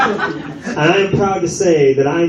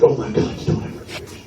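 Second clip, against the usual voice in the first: shocked whisper; 5 dB quieter, faster than usual. Retold or imitated. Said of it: "He's like".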